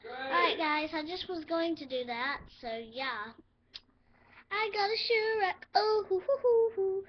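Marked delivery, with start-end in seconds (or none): 4.5-7.0 high, loud hooting cheer; celebrating out loud, much higher and louder; excited whoop. Real, not acted. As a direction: whoop it up over the new pet. Celebrating new pet.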